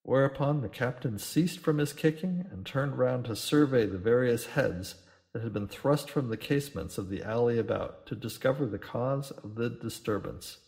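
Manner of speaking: usual speaking voice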